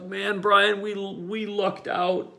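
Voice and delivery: high, slow relieved sigh of words. Retold or imitated. Driver to friend after the escape, slow and relieved. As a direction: breathe out the relief. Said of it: "I was like".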